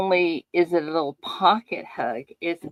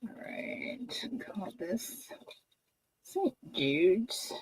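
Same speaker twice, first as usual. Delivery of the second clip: much softer unvoiced whisper; 2 semitones higher, 10 dB quieter than usual. Real, not acted. Labelling the donation for Saint Jude's, whispered. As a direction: murmur it to yourself.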